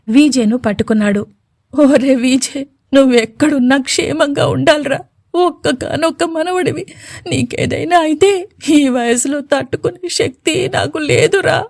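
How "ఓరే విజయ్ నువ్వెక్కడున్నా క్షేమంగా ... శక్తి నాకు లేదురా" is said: put-on high, trembling old grandfather's weeping voice; old man crying. A narrator's reading. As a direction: let the old grandfather break down in tears. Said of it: "బోర్మని ఏడ్చాడు".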